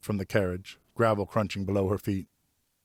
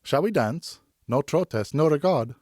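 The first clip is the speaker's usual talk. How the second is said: put-on young woman voice; 7 semitones higher, 4 dB louder, slower than usual. Geneviève invites to dance.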